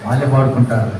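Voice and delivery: low, indifferent shrug of a delivery; half-hearted and low; joyless. Retold or imitated. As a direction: mimic a bored onlooker, pitch dropped. Interpreter mirrors the joyless indifference.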